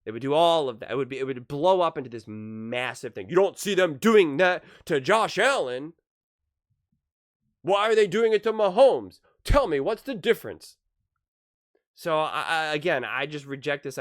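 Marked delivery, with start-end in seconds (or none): 3.3-10.8 loud, high mock outrage from the media; mock the media's indignant yelling; pundit freaking out on air. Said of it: "the media would freak out".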